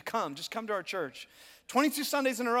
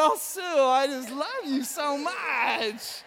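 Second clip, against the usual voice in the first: slow, drawn-out syrupy gush; mock syrupy adoration; 4 semitones higher, 4 dB louder, much slower than usual. Pastor fawning over Sue after her gift, slow and syrupy. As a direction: lay the love on thick. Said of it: "I just started".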